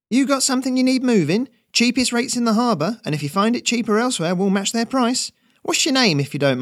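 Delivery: high, loud sales pitch; young Ferret hustling. Acted. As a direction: rattle off the deal to win the customer. Ferret pitches his rates.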